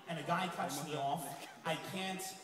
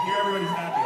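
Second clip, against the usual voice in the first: mock high squeak; 8 dB louder, much faster than usual. Comedian teasing audience.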